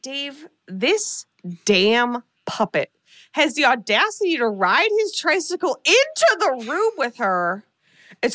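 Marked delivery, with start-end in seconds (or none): none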